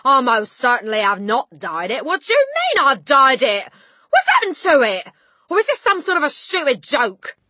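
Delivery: loud, high-pitched denial; indignant yell. A narrator's reading. Father denying he dyed it, indignant yell. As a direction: protest at full voice. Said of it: "the father yelled".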